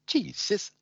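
high, teasing exclamation; saucy. Acted. Saucy triad to her scolding sister, high and cheeky. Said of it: "And Saucy Triad says".

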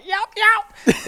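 high drawled hen yelp call; turkey hen call with a drawl; southern hen yelp. Retold or imitated. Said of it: "it's just a southern hen yelp, you know, with a drawl".

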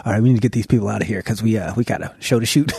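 low, fast hurried voice; mock set guy. Set guy clearing the cast, low and hurried. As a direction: rush everyone out. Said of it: "set guys was like".

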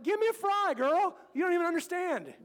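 bossy dad claiming the fry, hammed up; mock demand. Dad demanding a fry from his pouting daughter, hammed up.

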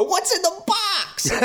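high, slow, anguished Brad Pitt cry; desperate shout of the famous line; Brad Pitt in Seven. Retold or imitated. Reviewer parodies Brad Pitt in Seven with a high anguished cry. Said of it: "going full on Brad Pitt Seven".

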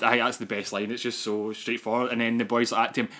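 normal talking voice

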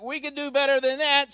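mock-boastful German soldiers' shout; German soldiers' reply. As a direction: call it back across the trenches. German soldiers answer the British carols.

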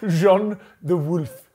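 slow, careful French-accented Jean de Wolf. Shown as a demonstration. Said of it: "he always adopts an accent".

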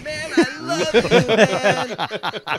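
put-on gushing drunk. Drunk stranger hugging the narrator, slow, loud mock gushing. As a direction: ham up a sloppy drunk declaring love.

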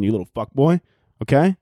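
ordinary delivery

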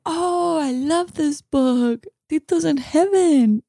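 lightly hammed-up toddler enthusiasm; excited little daughter. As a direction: imitate a delighted small girl. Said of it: "And she was like".

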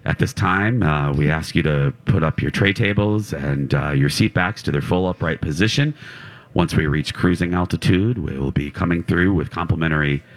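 lightly voiced airline announcement; flight attendant. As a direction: read it like a routine cabin announcement. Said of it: "pre-flight announcements are all pretty standard".

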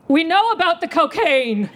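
put-on high little-kid outburst; blurted out. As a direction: blurt it in a high, accusing squeal. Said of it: "I blurt out".

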